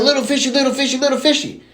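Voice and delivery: high, excited sing-song chant; little kid taunt. Acted. Little Johnny telling dad what he did, high silly chant.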